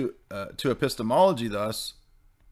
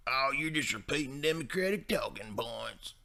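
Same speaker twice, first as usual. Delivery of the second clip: dismissive boomer; 4 semitones higher, 8 dB quieter than usual. Retold or imitated. Boomer defending Bush to a critic, mock-dismissive. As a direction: imitate a boomer waving off criticism. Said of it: "a lot of boomers were like".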